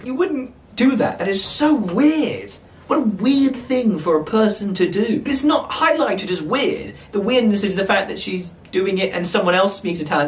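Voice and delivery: real exasperated disbelief; incredulous rant. Real, not acted. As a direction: protest how bizarre this is. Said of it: "that was a pretty long rant".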